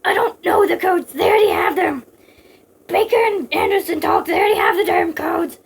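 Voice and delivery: high, loud, rough-edged protest; shouting. Acted. Snake to Big Boss about the codes, desperate shouting. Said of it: "Snake shouted".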